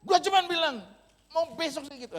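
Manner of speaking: high, very loud yelled protest; teriak marah. Retold or imitated. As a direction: shout your defence back at the other car. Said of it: "Teriak juga saya". (berteriak membela diri, nada tinggi)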